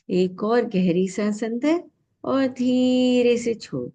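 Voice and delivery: slow, stretched words with a held tone; guided breath cue. Real, not acted. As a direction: pace a long breath in and out. (सांस के साथ धीमी, खिंची हुई आवाज़)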